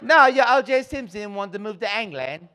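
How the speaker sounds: put-on high-pitched English accent retort; mock English accent. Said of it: "talk like that's weird".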